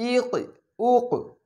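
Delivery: ইক, উক drawn out slowly, each final ক bounced; qalqala bounce. Shown as a demonstration. Teacher slowly repeating the qalqala drill with bounced endings. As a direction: stretch ইক and উক, then bounce each ক. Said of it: "এইটাই হচ্ছে মাখরাজে ধাক্কা দিয়ে পড়া".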